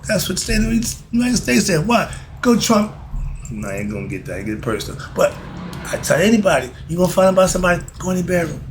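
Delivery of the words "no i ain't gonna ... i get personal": muttered aside. Real, not acted.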